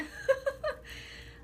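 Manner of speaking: high laugh through tears; tearful laugh. Real, not acted. Laughing at herself for crying on camera, tearful laugh. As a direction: break into a laugh while crying. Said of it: "why am I crying?".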